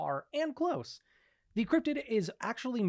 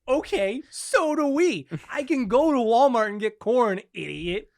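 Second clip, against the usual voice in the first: higher, louder sarcastic comeback; scornful retort; 7 semitones higher, 9 dB louder, slower than usual. Retold or imitated. Mocking an Ohio friend, higher and louder with scorn. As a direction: scoff at him like he's an idiot.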